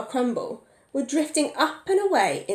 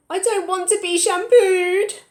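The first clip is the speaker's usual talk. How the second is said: put-on high, louder childish wail; wailing boy; 8 semitones higher, 6 dB louder than usual. Boy in the bath wailing at his mother. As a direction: whine it like a boy refusing shampoo. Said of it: "wailed the boy".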